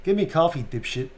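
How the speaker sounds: put-on East Coast accent, jumping to a high loud order; Boston-ish, pushy command; Gavin Reed, Boston-ish. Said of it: "kind of Boston-ish".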